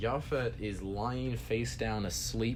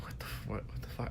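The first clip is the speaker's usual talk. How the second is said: unvoiced, much softer disbelief; whispered shock; 6 dB quieter, faster than usual. Waking to find the friend gone, whispering in shock. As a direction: whisper it, stunned he's gone.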